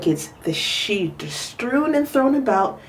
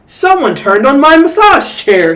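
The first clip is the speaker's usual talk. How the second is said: louder indignant bellow; 5 semitones higher, 8 dB louder than usual. A narrator's reading. Mister Chan bellowing about his massage chair. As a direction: boom it out. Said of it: "he bellowed".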